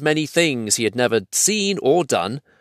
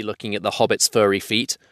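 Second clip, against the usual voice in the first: plain reading voice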